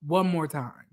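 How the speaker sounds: quietly exasperated threat; annoyed. Retold or imitated. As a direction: mutter it low, thoroughly annoyed. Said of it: "I was just so annoyed".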